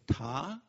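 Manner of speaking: "Ta" sweeping up in pitch; rising tone. Shown as a demonstration. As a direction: Mandarin rising tone on "Ta". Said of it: "Four major tones".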